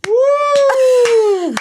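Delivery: high, loud crowd woo; hammed-up crowd cheer; mock cheer. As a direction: cheer like a thrilled contestant. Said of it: "everyone goes".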